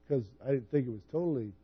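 ordinary delivery

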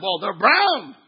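loud outraged wail landing on brown; hammed-up protest, blasting the last word; mock upset. Churchgoer upset about chair color, mock outrage. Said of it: "that's what you're upset about".

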